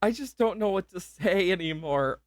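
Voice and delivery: genuinely moved and struggling to take it in; overwhelmed, near tears. Real, not acted. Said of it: "Can hear it in my voice".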